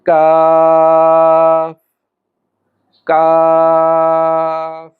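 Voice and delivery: কাফ with tongue back to palate, very long aa; back-of-tongue k sound. Shown as a demonstration. Teacher demonstrating the letter from the palate, কাফ drawn out. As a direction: hold each কাফ on a long steady vowel. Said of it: "যেমন আমরা উচ্চারণ করি".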